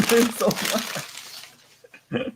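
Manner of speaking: laughing at the endless paper; high amused laugh. Real, not acted. Laughing at more wrapping.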